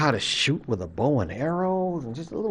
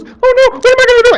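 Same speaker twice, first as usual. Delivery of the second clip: much higher, louder frightened cry; panicked; 13 dB louder, much faster than usual. Acted. Mother fleeing the bear with her baby, crying out in panic. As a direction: cry out in panic while running. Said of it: "And she goes".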